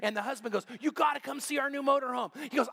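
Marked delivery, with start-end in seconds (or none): 0.8-2.3 high-pitched, eager imitated invitation; eager boasting. Retired husband shows off new motorhome, excited. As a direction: urge them over, proud and pitched up. Proud husband urges friends over.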